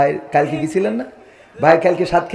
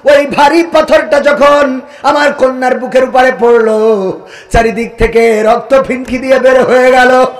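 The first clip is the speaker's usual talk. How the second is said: melodic sermon storytelling; 5 semitones higher, 7 dB louder than usual. A narrator's reading. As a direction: tell the tragic tale in a singsong preacher's cadence. Preacher resumes the chanted tale.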